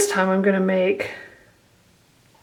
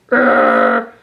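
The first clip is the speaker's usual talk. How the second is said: deep growl. Pretend. Voicing angry cartoon face.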